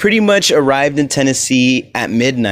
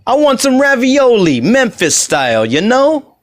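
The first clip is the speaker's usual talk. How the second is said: much higher, louder cartoonish Italian-American accent; mock Memphis Italian accent; 11 semitones higher, 4 dB louder than usual. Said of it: "that stupid accent that they have".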